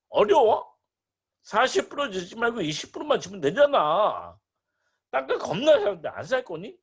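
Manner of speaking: high, insistent sales pitch; pushy land broker. Acted. Broker urging a buyer to take cheap land, pushy and high. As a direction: press the buyer hard, playing a broker. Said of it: "중개업자 얘기합니다".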